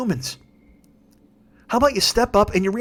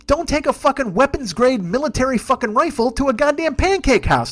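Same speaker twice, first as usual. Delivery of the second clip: ordinary talk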